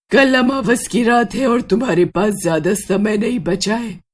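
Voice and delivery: an otherworldly witch, grave and ominous; ghostly witch warning. Acted. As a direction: speak as a ghost revealing a dark secret. Witch warns Sheetal about Rajbir. (रहस्यमयी चुड़ैल की गंभीर चेतावनी)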